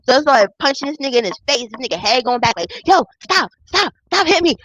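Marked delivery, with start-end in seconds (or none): 2.9-4.6 high-pitched mimicry of a man begging; hammed-up plea for mercy; mock pleading. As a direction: imitate him crying out under punches. Mimics man being punched.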